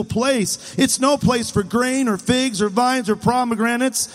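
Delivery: high, whiny litany of complaint; whining. Acted. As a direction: whine on and on in protest. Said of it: "Can you hear 'em whining?".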